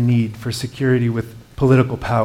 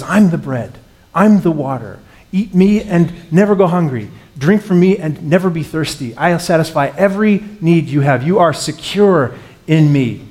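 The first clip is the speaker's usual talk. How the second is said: loud, higher-pitched preaching; impassioned proclaiming; 5 semitones higher, 6 dB louder than usual. Acted. Preacher voicing Jesus's promises to those afraid of losing jobs, impassioned proclaiming. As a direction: drive each promise home with raised voice.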